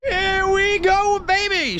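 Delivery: high, slow, stretched cheer; hyped-up. Real, not acted. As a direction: celebrate the catch out loud. Fish caught, hype.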